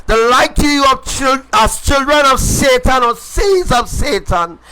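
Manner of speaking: ordinary delivery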